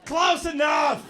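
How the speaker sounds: slow, high, loud exaggeration; mocking shout. Comic replaying the guy's answer, mocking shout. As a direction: blow up the brush-off into a shout.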